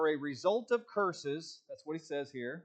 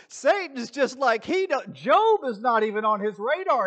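high, loud emphatic aside; excited; 8 semitones higher, 11 dB louder than usual. Real, not acted. Pastor pressing his point about Job, high and loud. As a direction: drive the point home with rising heat.

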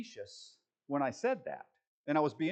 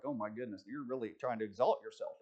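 mock muttered reproach; 6 semitones lower, 6 dB quieter than usual. Mocking reaction to false humility.

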